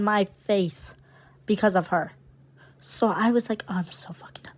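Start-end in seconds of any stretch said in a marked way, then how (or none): 3.7-4.5 breathy whispered retort under her breath. Retold or imitated. Retelling a muttered comeback to the rude attendant, whispered. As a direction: hiss it quietly so she can't hear. Quietly cursing at flight attendant.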